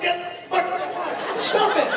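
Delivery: high, laughing mock yell; startled yell. Dad startled by his daughter, yelling high and laughing. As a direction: yelp it out, half laughing. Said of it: "I'll be like".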